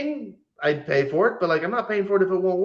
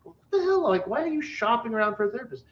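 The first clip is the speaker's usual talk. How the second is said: incredulous; 7 semitones higher, 5 dB quieter, faster than usual. Retold or imitated. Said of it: "I was like".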